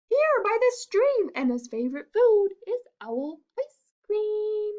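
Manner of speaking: a bright little mouse, politely refusing. Acted. Mouse turns down the fox, high and squeaky.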